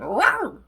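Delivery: short, high, loud mock roar; Lightning roar. Shown as a demonstration. Showing off an impression of Lightning, high punchy roar. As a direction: squeal out one playful creature "rawr". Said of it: "that was not a bad impression".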